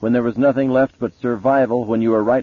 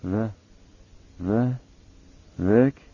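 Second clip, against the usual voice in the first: creaky whisper; 2 semitones higher, 3 dB quieter, slower than usual. Acted. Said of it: "she croaked in a whisper".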